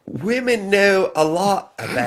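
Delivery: first-try fake English accent, high and drawn out; slow, Northern-sounding mock English accent. Imitating a guest on Loveline, exaggerated Northern English accent. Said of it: "I'll try to do her ... fake fake accent".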